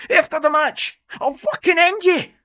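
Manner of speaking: restrained keeper vowing revenge on Aldo; furious threat. Acted. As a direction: spit out a promise of violence.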